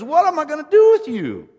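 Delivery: very high, very loud cry of exasperation; exasperated, furious. Acted. God fed up with his people, furiously exasperated. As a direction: throw your hands up and cry it out. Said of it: "throws the divine hands up in exasperations".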